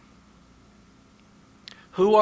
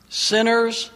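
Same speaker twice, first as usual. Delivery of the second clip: preaching at full force; 3 semitones higher, 4 dB louder than usual. Acted. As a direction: drive each question home to the congregation. Preaching climax, rhetorical questions.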